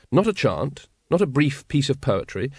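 ordinary delivery

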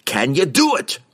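high, forceful dwarf demand; impatient roar. A narrator's reading. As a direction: roar it, out of patience. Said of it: "Bruner roared".

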